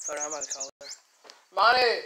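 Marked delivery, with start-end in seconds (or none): 1.5-2.0 calling upstairs, loud and high; yelling across the room. Real, not acted. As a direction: shout up the stairs to her. Calling sister from downstairs.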